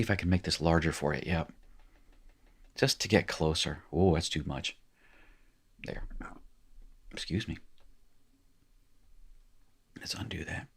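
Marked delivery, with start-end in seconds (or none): 3.3-10.7 barely audible whisper; muttered to self. Real, not acted. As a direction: whisper it to yourself while fixing something. Muttering to self while fixing screen.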